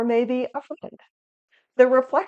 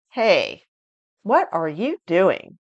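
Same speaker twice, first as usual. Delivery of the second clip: judgmental; 4 dB louder, slower than usual. Shown as a demonstration. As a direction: make it sound like an accusation. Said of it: "it's very judgmental. It might even sound angry".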